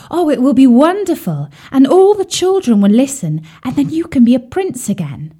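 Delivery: high, eager and bright; excited. Acted. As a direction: bubble over with excitement. Swan excited by idea.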